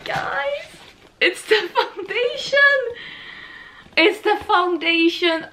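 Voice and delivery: high-pitched thrilled cry; excited squeal. Real, not acted. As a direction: squeal with delight. Discovering the foundation shades.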